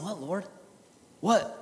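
soft, hushed asking of God; quiet plea. Retold or imitated. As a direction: whisper the question to the Lord. Pleading prayer retold.

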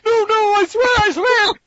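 high sobbing shouted plea; terrified pleading. Acted. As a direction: beg for mercy in a crying squeal. Jameson begging the Goblin.

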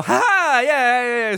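high, bright mock chuckle to a stranger; mock friendly yeah yeah; friendly laugh-off. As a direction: laugh it off to show you're harmless. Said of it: "smile, like".

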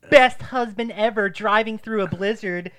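high, loud gushing caption; gushing wife. Pretend. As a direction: gush like a show-off.

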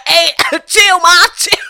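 high, loud pleading dog voice; choked dog voice. Retold or imitated. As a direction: beg as the choking dog. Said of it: "the dog is like".

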